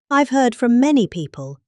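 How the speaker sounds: ordinary delivery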